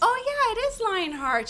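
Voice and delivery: high bright mock-casual reply. Owner answering a stranger about her earrings, high and playful. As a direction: play it cool while hiding a secret.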